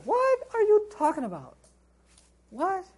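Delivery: high-pitched disbelieving outburst; incredulous. Acted. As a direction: react as if that is absurd. Scornful reply to a naive claim.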